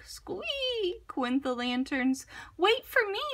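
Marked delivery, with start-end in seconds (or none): 2.6-3.2 much higher tiny mouse voice; high mouse calling after friends; little mouse crying. A narrator's reading. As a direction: squeak it out like a small mouse left behind. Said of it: "cried Mouse".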